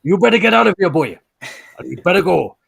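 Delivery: Jones. Retold or imitated. Pub brawler sending a stranger away, mock Welsh accent. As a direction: Welsh celebrity voice, badly imitated. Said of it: "then I've gone into Jones".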